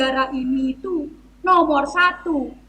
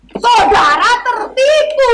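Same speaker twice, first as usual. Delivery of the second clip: hammy stage actor; 6 semitones higher, 4 dB louder than usual. Acted. Officer dismissing the narrator.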